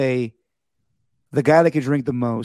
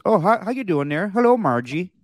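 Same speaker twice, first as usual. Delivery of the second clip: put-on Fargo accent; 6 semitones higher than usual. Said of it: "every scene in Fargo was".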